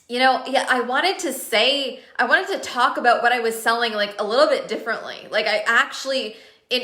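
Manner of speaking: usual speaking voice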